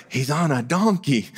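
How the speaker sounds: high, giddy voice of an onlooker; joyful excitement. Acted. Crowd thrilled to see the king ride in, giddy and high. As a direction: act out a thrilled crowd member. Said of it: "this great joy they must have felt".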